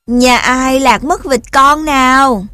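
loud, lightly voiced cow; booming cow call. A narrator's reading. Cow joins the search for the family, booming call. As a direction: call out loudly like a lowing cow. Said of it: "cũng ồm ồm kêu lên".

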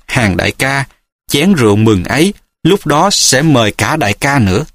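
plain reading voice

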